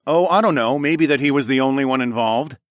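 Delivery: smirking. A narrator's reading. Hero pointing out the obvious, smirking. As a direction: feign pondering with a smirk. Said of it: "I pointed out with a smirk".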